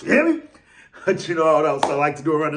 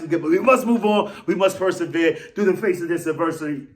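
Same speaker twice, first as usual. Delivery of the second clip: ordinary delivery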